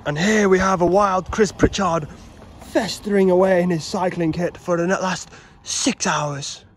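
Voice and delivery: lightly hushed wildlife narrator; a hint of nature presenter; nature documentary voice. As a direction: present him like a rare animal. Wildlife documentary style intro.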